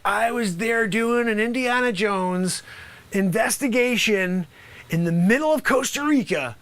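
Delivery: ordinary delivery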